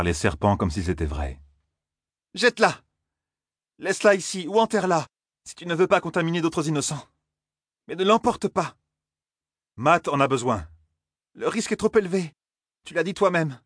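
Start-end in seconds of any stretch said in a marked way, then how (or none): 2.3-13.6 urgent pleading. A narrator's reading.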